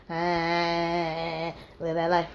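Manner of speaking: low, soft blissed-out sigh; low, soft, lost in the moment; dreamy club murmur. Retold or imitated. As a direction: sink into the music. Club dancing reverie.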